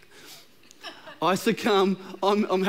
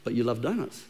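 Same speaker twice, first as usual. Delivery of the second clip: whispered temptation; 6 semitones lower, 4 dB quieter than usual. Pretend. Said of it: "but there's".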